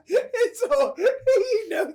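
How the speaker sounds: high-pitched words through laughter; amused, giggling disbelief; real laughing. Real, not acted. Teasing a friend who knows the movie by heart, laughing.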